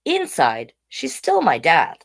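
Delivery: usual speaking voice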